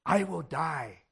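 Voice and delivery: dread. Retold or imitated. Convicted young person realizing death, low and hushed. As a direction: let the fear drop to a murmur.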